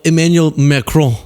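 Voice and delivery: "Emmanuel Macron" with a French nasal ending; French pronunciation. Shown as a demonstration. Commentator defending how they say "Emmanuel Macron", French pronunciation. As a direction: say "Macron" as mah-kroh, final n unsounded. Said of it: "being silly when I go".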